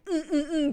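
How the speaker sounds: loud, high mock grunts; exaggerated frustrated "mm" noises; mimicked grunting back. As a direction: grunt back in worked-up little bursts. Mimicking snapping back at kids.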